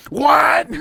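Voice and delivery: very high, loud shriek; mock freak-out. Imitating a cornered murderer who breaks character, shrieking freak-out. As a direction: explode in hammed-up disbelief. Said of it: "And they freak out".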